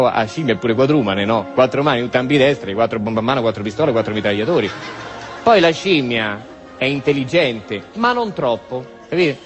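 usual speaking voice

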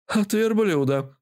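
высокий голос недовольной старухи; отмахнись от вопроса высоким бабкиным голосом; ворчливая бабуля. A narrator's reading. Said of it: "буркнула бабуля, явно не желая обсуждать". (бабка буркает, не желая говорить)

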